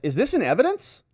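high-pitched, sharply questioning; mock judge's query. Judge asking whether the exhibit is in evidence, querying. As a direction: interrupt with a high, pointed question.

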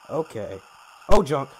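usual speaking voice

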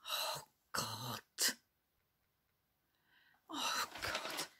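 hushed, breath-heavy exasperation; very soft, sighing dismay; despairing mutter. Acted. Private despair after household interruption.

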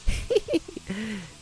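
high-pitched acted cackle; old woman's cackle. A narrator's reading. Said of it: "She cackled".

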